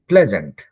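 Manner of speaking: प्लेजेंट said clearly as "plez-ant"; model pronunciation. Shown as a demonstration. Teacher shows the class how English speakers say it, clearly. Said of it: "आप सुनिएगा कैसे बोलता है अंग्रेज लोग".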